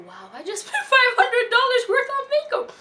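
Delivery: high and loud, laughing through the words; laughing disbelief. Real, not acted. Leaving the store stunned, laughing and loud. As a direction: giggle at your own madness.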